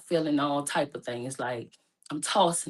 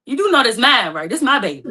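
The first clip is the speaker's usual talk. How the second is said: playful insistence; 5 semitones higher, 8 dB louder than usual. Retold or imitated. Teasing mom about the baby.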